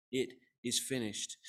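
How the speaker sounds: slow, deliberate quote, kept low-key; solemn quote. Retold or imitated. Preacher quoting Jesus' last words, slow and measured. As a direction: quote it slowly, with weight. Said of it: "John tells us what he actually said".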